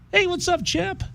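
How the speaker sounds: high, excited fanboy hello; fanboy greeting. Retold or imitated. Fan spotting his hero on a plane, gushing. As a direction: gush at the champ like a starstruck fan.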